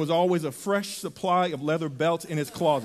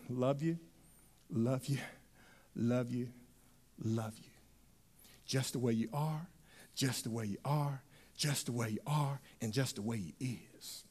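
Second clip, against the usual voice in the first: God's tender murmur; 8 semitones lower, 15 dB quieter than usual. Acted. God tells the listeners he loves them, hushed and low. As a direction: lower and much softer, a near-whisper. God's repeated loving whisper.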